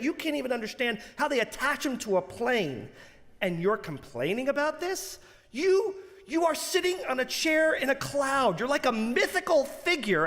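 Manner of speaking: mock a comedian scolding a spoiled passenger; high, worked-up comic lecture; exasperated rant. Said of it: "Louis C K looks at the guy and says".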